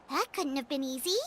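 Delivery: soft sympathy. Acted. Consoling the elder.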